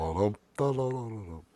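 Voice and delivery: put-on puppet dum-dums; pumpkin voice demo. Shown as a demonstration. Host still playing with pumpkin voice, character humming. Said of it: "What if this were his voice?".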